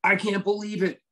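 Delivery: high, gushing mock excitement; imitating a thrilled fan. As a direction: play the starstruck fan meeting him. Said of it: "She goes".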